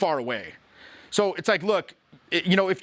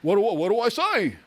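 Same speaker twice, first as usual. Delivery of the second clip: mock worried student; 3 semitones higher than usual. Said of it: "begs the question".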